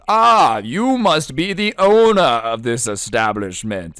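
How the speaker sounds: grand, slow theatrical royalty; overacted regal address; hammed-up king. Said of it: "hamming it up when it comes to the acting".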